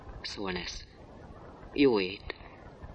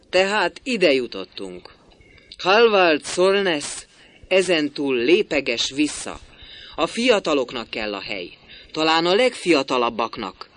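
bitter laugh; 6 semitones higher, 6 dB louder than usual. A narrator's reading. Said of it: "Keserűen nevet".

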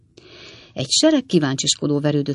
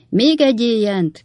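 higher, moderately raised cry; mild storybook shout, pitched up; calling out; 6 semitones higher, 3 dB louder than usual. A narrator's reading. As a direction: call for a cheer in a raised narrator's voice. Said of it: "kiáltotta a kis fürge főkolompos".

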